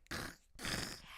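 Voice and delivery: wet hacking hairball cough. Pretend. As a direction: hack like a cat with a hairball. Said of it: "It's a very effective hairball noise".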